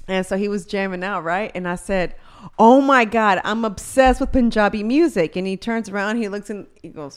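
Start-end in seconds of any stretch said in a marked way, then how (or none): 2.6-5.3 excited gush. Retold or imitated. Retelling own excited quote.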